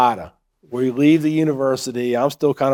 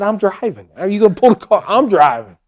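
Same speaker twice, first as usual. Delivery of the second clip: higher, incredulous mock outburst; retold indignant protest; 6 semitones higher, 5 dB louder than usual. Husband arguing with wife over the car, indignant. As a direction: protest it louder and higher, in disbelief.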